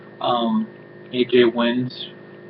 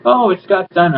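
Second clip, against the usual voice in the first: higher, louder excited guess; mock excited guess; 5 semitones higher, 6 dB louder than usual. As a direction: play the thrilled crowd guessing. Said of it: "everyone's like".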